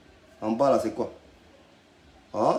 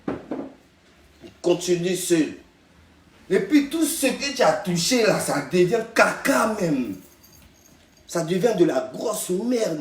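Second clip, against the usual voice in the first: mocking sneer; 5 semitones higher, slower than usual. Mocking rant about rival's career.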